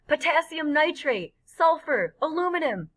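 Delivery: high-pitched list, each name called out; excited shouting. A narrator's reading. Freak shouting out names of chemicals at fireworks, excited shouting. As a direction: call out each burst as it comes. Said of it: "Freak keeps on shouting out the names".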